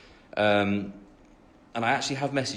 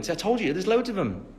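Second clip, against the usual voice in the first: ordinary talk, nothing marked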